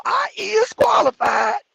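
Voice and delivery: high shouted slow mock; mock applicant. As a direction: yell the wrong grammar proudly.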